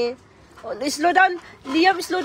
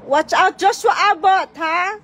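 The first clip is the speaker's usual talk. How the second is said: sharp playground warning; 6 semitones higher, 7 dB louder than usual. Real, not acted. Mom calling to biking child.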